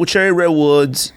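usual speaking voice

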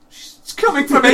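high, loud comic shriek; mock panic. To his co-host as the lights dim, mock panic. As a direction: cry out in hammed-up terror.

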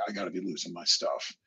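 low, soft muttering to himself; disbelieving mutter. Retold or imitated. Seeing a silhouette in the glass, low mutter.

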